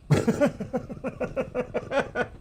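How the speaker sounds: long high giggle, kept soft; laugh. Real, not acted. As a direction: laugh it off with a rapid high chuckle. Laughs after warning about New Yorkers.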